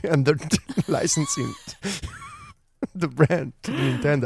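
words interrupted by real giggles; laughing through words. Real, not acted. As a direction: laugh your way through the phrase. Laughs at own absurd guess.

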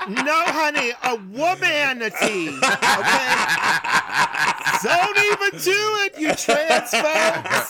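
loud, laughing diva sass; sassy diva. Acted. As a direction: belt it out with mock outrage. Joking diva rebuke of friends.

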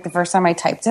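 ordinary talk

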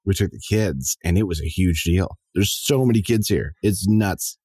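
usual speaking voice

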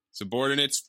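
ordinary delivery

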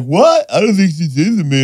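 high, incredulous wife voice; wife's surprised doubt. Retold or imitated. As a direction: play the wife, thrown and unsure.

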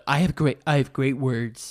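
a badly faked Donald Trump; lightly voiced Trump bragging; Donald Trump impression. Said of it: "that was the worst impression".

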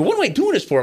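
high, exasperated cry; exasperated outburst. Retold or imitated. Calling the boss after being crushed, high exasperated outburst. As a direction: throw the question up in disbelief. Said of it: "And I go".

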